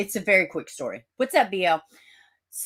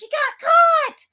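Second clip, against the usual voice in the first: much higher, loud mocking yell; imitated scream; 8 dB louder than usual. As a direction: shriek it like the guy bouncing through the store. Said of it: "He's screaming".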